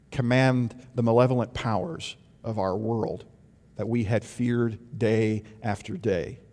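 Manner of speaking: usual speaking voice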